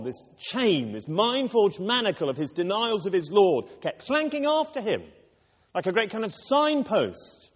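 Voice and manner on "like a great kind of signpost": usual speaking voice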